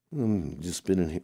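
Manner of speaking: usual speaking voice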